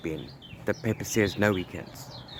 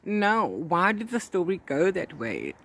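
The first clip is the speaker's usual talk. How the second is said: a sudden high "Oh", then a slow, pained "why"; mock-anguished reader wailing at a twist; dismayed reader's outburst; 7 semitones higher, 6 dB louder, slower than usual. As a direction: cry out in disbelief at the plot. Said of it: "and it's like".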